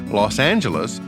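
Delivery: ordinary talk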